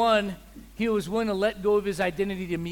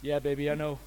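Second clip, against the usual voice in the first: smug laughing reply; 5 semitones lower than usual. Retold or imitated. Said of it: "And I said".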